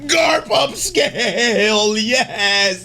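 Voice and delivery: slow, high, drawn-out cheer; exultant hype shout; celebratory shout. Real, not acted. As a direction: stretch it into a triumphant yell. Celebrates favourite character's moment.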